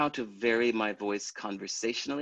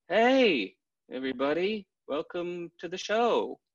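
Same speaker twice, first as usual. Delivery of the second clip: higher bursts on hey; a touch more energetic announcer; slightly more dynamic; 8 semitones higher, slower than usual. Retold or imitated. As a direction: try a bit harder. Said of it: "the next day I'd go out there and go".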